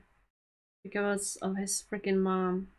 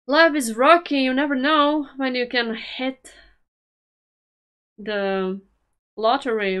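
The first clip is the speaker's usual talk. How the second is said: louder, higher pep-talk lift; upbeat encouragement; 4 semitones higher, 7 dB louder than usual. Acted. Reacting to the footballer, loud encouraging pep talk. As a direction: cheer him on brightly.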